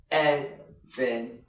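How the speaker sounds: "advantage" slowly split as "ad, van, tij"; teacher spacing out each syllable of "advantage"; three separate syllables. Shown as a demonstration. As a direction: break "advantage" into three slow beats. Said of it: "Three syllables".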